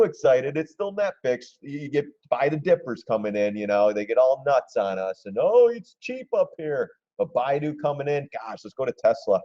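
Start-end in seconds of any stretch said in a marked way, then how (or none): 5.4-6.9 much higher, slowed, naive mock voice; mock dip buyer. Streamer mocks dip buyers chasing the price, high naive mock voice. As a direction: sound like a gullible buyer thinking it's cheap.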